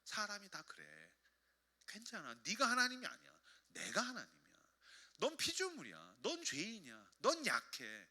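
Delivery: very soft, tender comfort; hushed, fatherly reassurance, acted; God reassuring. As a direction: speak gently as God consoling a child. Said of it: "하나님이 그때 뭐라고 하신다고요?".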